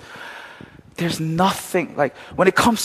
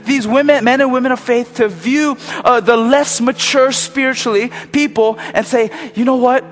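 higher, louder, rising sermon fervour; impassioned preaching; 7 semitones higher, 3 dB louder than usual. Acted. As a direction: preach it with building passion. Preaching at full force.

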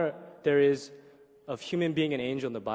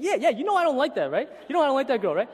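defensive protest; 11 semitones higher, 8 dB louder than usual. Retold or imitated. Defensive rant at a friend.

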